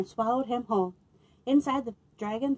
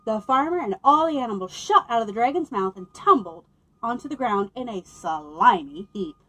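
ordinary delivery, nothing marked

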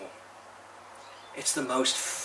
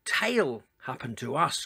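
groaning; 3 semitones higher, 7 dB louder than usual. A narrator's reading. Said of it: "groaned Lenny".

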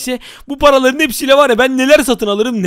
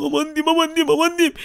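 high, softer fretting; hushed mock panic; 6 semitones higher, 4 dB quieter than usual. Gamer sneaks past gangsters, high hushed mock panic. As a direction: fret in a small high voice.

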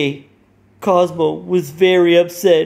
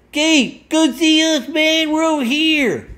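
much higher cartoon voice calling over; high cousins calling; 12 semitones higher than usual. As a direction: wave him over in a squeaky voice.

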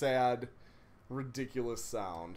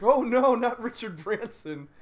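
mock gasp; 6 semitones higher, 7 dB louder than usual. Mock worried fan reaction.

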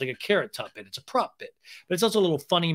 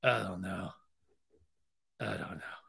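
low, very soft imitation; mock helpless murmur trailing away; dejected mumble; 8 semitones lower, 11 dB quieter than usual. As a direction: drop to a tiny, defeated acted voice. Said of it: "Sandoval shakes his head and goes".

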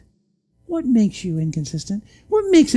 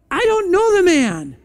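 denial with oath; 10 semitones higher than usual. Acted. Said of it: "he denied it with an oath".

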